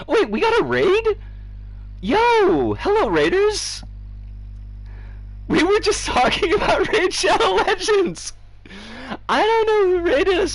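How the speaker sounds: high, loud and thrilled; excited greeting. Real, not acted. Streamer thrilled to greet incoming raiders, high excited shout. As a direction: cheer the raiders in.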